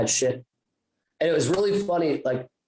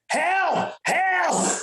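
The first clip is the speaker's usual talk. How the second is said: much higher, wailing mock panic; stuck in a puddle, hammed-up high wail for help; mock cry for help. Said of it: "I'm like".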